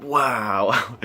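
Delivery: big drawn-out wow, played up; amazed wow. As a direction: imitate a friend blown away. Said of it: "he's just like".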